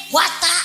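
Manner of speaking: "bata" with open A sounds, not O; Cilacap ngapak accent. Shown as a demonstration. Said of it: "belakangnya kalau ngomong pakai A". (logat ngapak, "bata" dengan bunyi A)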